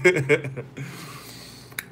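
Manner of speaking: high amused laugh at himself; real laugh. Real, not acted. Vlogger laughing at the psychic's prediction, amused. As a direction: laugh off the idea. After retold disbelief.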